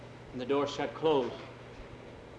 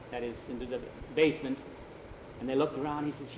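plain reading voice

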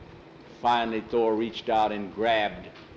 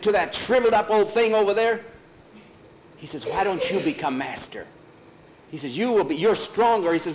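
higher, louder pleading voice; urgent persuading boy; 6 semitones higher, 4 dB louder than usual. Acted. Jackie urging Thor to turn on master, urgent. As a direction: talk him round, urgently.

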